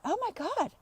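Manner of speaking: high, soft gasp of dawning realisation; hushed high-pitched mock shock. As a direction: act out suddenly catching yourself. Acts out her sudden realisation.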